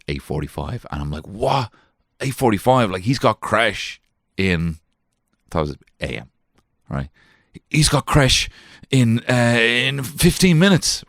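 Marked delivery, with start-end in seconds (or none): 1.3-4.8 panicked surprise. Retold or imitated. Panicked waking, retold. 7.7-11.0 high, slow and louder, alarmed; dad realising he's late, high and alarmed; panicked surprise. Retold or imitated. Dad panicking about the time, high and slow.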